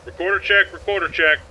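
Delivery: clipped radio operator voice; firm radio call. Shown as a demonstration. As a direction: bark it like a radio check. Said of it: "Let's get the recorder on, for example".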